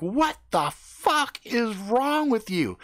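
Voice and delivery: high, slow, incredulous demand; appalled outrage. Retold or imitated. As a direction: draw it out in exasperated disbelief. Confronts headbanging friend, appalled.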